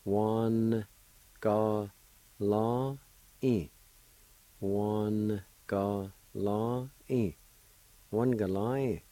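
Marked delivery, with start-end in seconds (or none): 0.1-3.7 Wan, ga, la, in spoken as separate beats; teacher splitting the word for students; syllable by syllable. Shown as a demonstration. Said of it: "pronounce the N sound with the W A like this". 4.6-6.9 One galai stretched out very slowly; teacher modelling slowly for learners; very slow drawn-out. Shown as a demonstration. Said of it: "It's pronounced like this".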